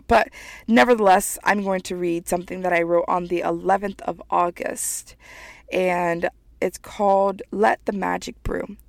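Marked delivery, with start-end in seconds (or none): none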